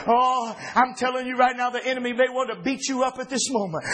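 usual speaking voice

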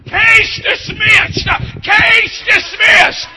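slow, high, loud ringing ruling; shouted verdict. Acted. Preacher proclaiming God's verdict, slow and shouted. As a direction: proclaim the verdict at full force.

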